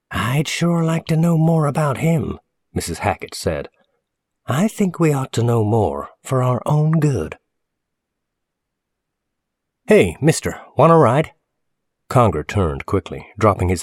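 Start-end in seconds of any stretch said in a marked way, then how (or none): 0.1-2.4 high-pitched, insistent busybody; suspicious woman customer. Acted. Customer to storekeeper about the bearded stranger, suspicious. 4.5-7.4 high-pitched busybody voice; suspicious woman customer. Acted. Customer urging storekeeper to look into stranger, suspicious. 9.9-11.3 high, loud hail; calling out. Acted. Kid in a car offering a stranger a ride, calling out.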